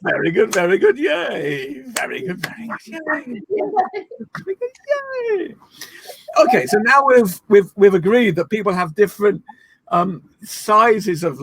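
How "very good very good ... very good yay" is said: high, drawn-out yays; playful cheering. Shown as a demonstration. Leader rounds off the exercise with class, gleeful cheer. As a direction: chant it rising into childlike glee.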